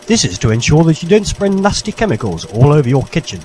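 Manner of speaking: normal talking voice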